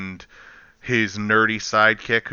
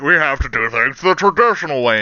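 lightly put-on stubborn traditionalist voice; mock traditionalist; 3 dB louder than usual. Builder arguing for old methods, mock stubborn voice. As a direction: imitate someone insisting on the old way. Mocking a traditionalist's repeated argument.

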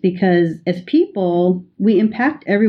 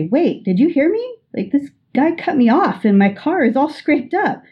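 higher, pushing harder to be heard; insistent friend; 8 semitones higher than usual. Acted. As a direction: repeat it like they didn't get it the first time. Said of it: "redouble their attempt to make you understand".

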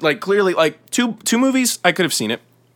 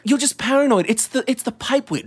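higher dismissive friend voice; high mocking voice; 10 semitones higher than usual. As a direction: tease him for being paranoid. Second character mocks.

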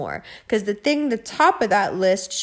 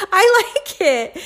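giggly insistence; 8 semitones higher, 4 dB louder, slower than usual. Real, not acted. Laughing defiance.

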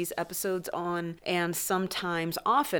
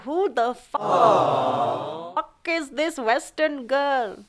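higher, slow, appalled disbelief; shocked inner voice; 5 semitones higher, 3 dB louder, much slower than usual. Retold or imitated. Imitating her own reaction to herself, stretched-out shocked disbelief. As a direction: drag it out in mock horror. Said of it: "I'm like".

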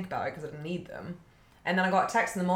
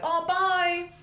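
bright high goodbye, a bit louder; high cheery bye; 10 semitones higher, 3 dB louder, much slower than usual. Retold or imitated. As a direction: sing out a quick farewell. Retold cheery goodbye to friends.

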